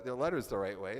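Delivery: ordinary delivery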